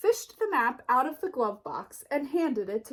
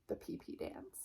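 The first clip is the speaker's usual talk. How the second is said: hushed, breathless whisper; whispered aside; 15 dB quieter than usual. A narrator's reading. Narrator whispers the silly dance name to the kids, hushed. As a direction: whisper it like a naughty secret. Playful aside in read-aloud.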